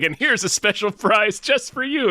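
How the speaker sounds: hammed-up kindly doctor; cheesy doctor voice. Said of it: "he goes like".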